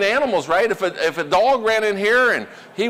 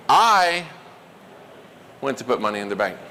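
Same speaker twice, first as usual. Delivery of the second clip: emphasis demo with I standing out alone; stress on I; 6 semitones lower than usual. Shown as a demonstration. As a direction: hit the word I, pause, then drop away. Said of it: "punch words for emphasis".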